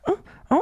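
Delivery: high-pitched happy shout; high excited yelp. Retold or imitated. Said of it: "está chido que mira".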